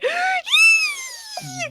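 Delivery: sharp high gasp of horror; existential dread. Retold or imitated. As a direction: gasp like you just met Death. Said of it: "the necromancer guy is just going".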